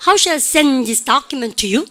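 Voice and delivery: slow chant punching "send" and "document"; stress on content words. Shown as a demonstration. Teacher leading a repeat-after-me, stress on "send" and "document". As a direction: drive hard into "send" and "document". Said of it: "따라해보세요".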